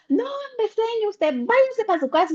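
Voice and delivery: high-pitched mock director, waving her off; quoted director. Teacher retelling the director telling her to go home, high and dismissive.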